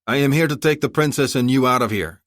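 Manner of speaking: ordinary delivery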